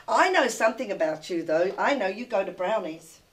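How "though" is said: usual speaking voice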